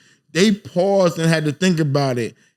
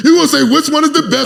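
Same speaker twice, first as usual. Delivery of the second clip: high, fast, louder protest; fast, raised, heated objection; 9 semitones higher, 5 dB louder, faster than usual. Real, not acted. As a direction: cut him off, voice jumping up. Heated borough argument.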